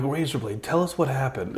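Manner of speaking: hushed, grave interview question; serious interviewer. Acted. Radio host interviewing fallen wrestler, serious hushed voice. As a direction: prompt him softly and seriously. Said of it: "Serious interview with Razorblade".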